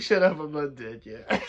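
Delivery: out of breath and tipsy. Real, not acted. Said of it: "he can't even breathe anymore".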